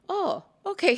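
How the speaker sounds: high, startled fatherly okay; surprised dad. Retold or imitated. Dad answers her hug request, high and surprised. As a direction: sound caught off guard. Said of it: "And he's like".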